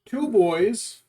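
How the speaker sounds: light up at the pets; cat greeting. Real, not acted.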